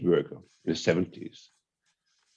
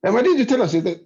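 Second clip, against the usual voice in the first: baffled question; 9 semitones higher, faster than usual. Retold or imitated. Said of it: "It's like".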